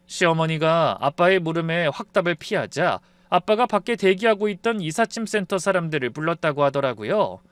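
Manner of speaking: usual speaking voice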